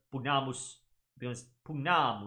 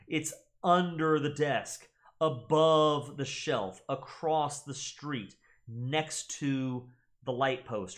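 slow listing; 4 dB louder, slower than usual. Shown as a demonstration. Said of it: "tends to show location".

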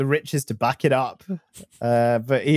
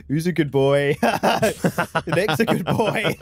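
dog praise voice; 3 semitones higher than usual. Pretend. Cooing at friend like a dog.

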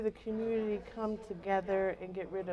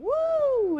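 cheer; 7 dB louder than usual. Real, not acted. Cheering the Dream Center invite.

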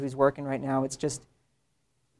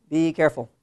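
usual speaking voice

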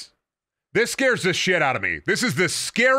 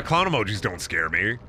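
usual speaking voice

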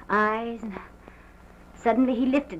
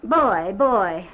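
retold call for help, much louder; two forceful shouted calls, re-enacted; loud cry; 8 dB louder than usual. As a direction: cry out loudly for the servant. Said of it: "cried out as loud as I could".

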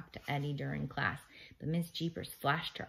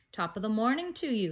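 higher, louder lilting greeting; calling out; 5 semitones higher, 5 dB louder than usual. A narrator's reading. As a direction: hail the children across the gym. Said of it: "he called".

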